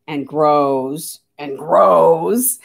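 low, very slow, stretched-out words; deep slow drag on "grows"; drawn-out growing. Shown as a demonstration. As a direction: stretch each word as it swells bigger.

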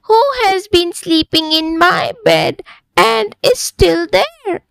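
high, gasping little bear voice; Baby Bear finding Goldilocks in bed, high and gasping; squeaky Baby Bear. A narrator's reading. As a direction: squeal it like a startled bear cub. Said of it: "Baby Bear gasped".

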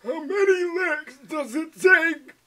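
put-on high, wavering old man voice; high-pitched old-timer impression. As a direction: play a creaky old man, slow at the end. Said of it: "Old man".

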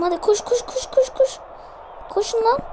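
high, rapid "koş, koş" pleading; will his players to sprint; frantic urging. Real, not acted. Gamer begging his players to run, high frantic urging.

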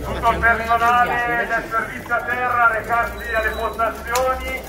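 loud public announcement. Real, not acted. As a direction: announce it loudly to the crew. Announcement to ground staff.

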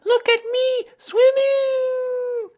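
very high, loud squeal; tiny child showing off; Roo. A narrator's reading. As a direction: squeal it, stretching the last word out. Roo answers from the pool.

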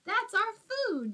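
high-pitched protest over the food; yelling. Acted. Apatosaur protests to Minosaur, high yell. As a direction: cry out that the food is theirs. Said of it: "yelled Apatosaur".

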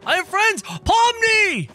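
high, loud shout; delighted high yell; excited calling out. Real, not acted. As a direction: holler to a friend just spotted. Calling out to a game character.

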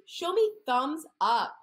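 bright sing-song storytime voice; reading to children. Acted. Speech therapist reading a story to preschoolers, sing-song. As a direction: read it like a picture book for little ones.

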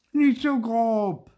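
high, drawn-out yelp; shrieked protest. A narrator's reading. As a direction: yell at someone handling you roughly. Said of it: "schrie Zäpfelkern". (hoch, laut und gedehnt schreiend)

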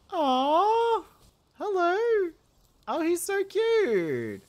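high squeaky cooing at a pet. Real, not acted. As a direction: coo like talking to a puppy. Cooing at a cute creature.

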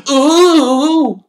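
high, loud thrilled coo; delighted boy's ooh; fascinated ooh. A narrator's reading. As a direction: gasp in delight.

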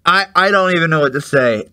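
usual speaking voice

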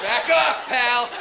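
high, loud mock tough-guy bark. To a threatening guy, towering, hammed-up tough talk. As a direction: puff up and warn him off, hammed-up. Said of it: "just be like".

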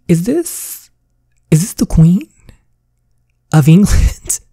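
stunned whisper rising to a cry; shocked gasp. Acted. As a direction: gasp it out as if you can't believe your eyes. Character spots the Queen, shocked.

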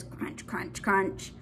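crunch, crunch, crunch whispered softly; soft whispered munching. Pretend. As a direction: whisper Panda's crunch, crunch like a secret. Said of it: "And Panda says".

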